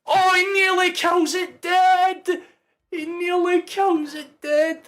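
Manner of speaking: high, loud match-call yelling; excited shout. Real, not acted. Fan yelling at the screen as a striker nearly scores, loud and high. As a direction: roar it like a commentator at the big chance. Streamer commentating live match moment.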